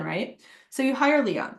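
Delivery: ordinary delivery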